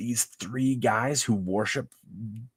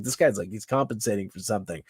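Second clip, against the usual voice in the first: normal talking voice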